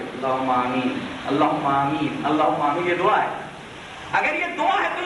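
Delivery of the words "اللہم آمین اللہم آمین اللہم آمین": very low, droning repeated prayer; mock supplication. Shown as a demonstration. Preacher demonstrates how such a prayer would go, low mock supplication. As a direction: intone it low and monotone, like someone praying. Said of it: "کہ آپ یوں دعا کیجئے".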